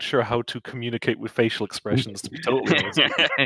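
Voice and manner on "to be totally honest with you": laughing. Real, not acted. Laughing aside.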